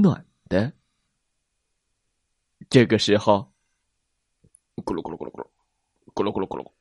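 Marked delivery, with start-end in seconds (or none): 4.8-6.7 low, soft growling tummy noise; tummy rumble. A narrator's reading. Narrator voicing T-rex's hungry stomach, low rumble. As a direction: rumble it deep and quiet. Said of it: "霸王龙的肚子咕噜咕噜叫了".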